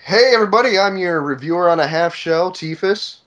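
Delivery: high, loud host greeting; show intro. Real, not acted. Host greeting the listeners, showy intro.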